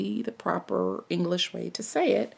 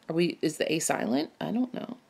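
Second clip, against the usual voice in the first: ordinary delivery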